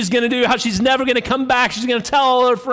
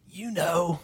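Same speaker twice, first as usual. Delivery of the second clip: soft, lower knowing jab; smiling, knowing; 4 semitones lower, 10 dB quieter than usual. Acted. Parrot taunts the lady who is ready for it, soft and knowing. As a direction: say it quietly with a sly smile. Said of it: "just kinda smiles and says".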